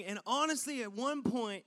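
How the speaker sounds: ordinary talk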